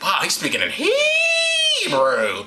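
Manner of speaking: high, drawn-out crowd wonder; mock amazement. Crowd hearing Paul speak Hebrew, mock amazed. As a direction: stretch it out in hammed-up awe.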